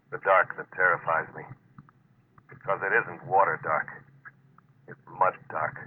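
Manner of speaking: low, hushed first-person narration; dread-filled voiceover; inner monologue narration. Acted.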